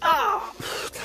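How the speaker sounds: high strained cry of pain; sharp yelp from back pain. Real, not acted. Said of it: "mes reins".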